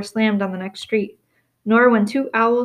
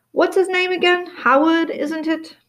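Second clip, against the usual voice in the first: high, light put-on innocence; feigned innocence; 8 semitones higher than usual. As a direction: pretend to forget the name. Dursley feigns forgetting the name.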